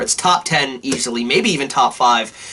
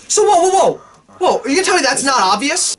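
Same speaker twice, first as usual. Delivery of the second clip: incredulous whoa; 10 semitones higher than usual. Real, not acted. Podcaster scoffing at the obvious lie, high and incredulous. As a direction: burst out in disbelief.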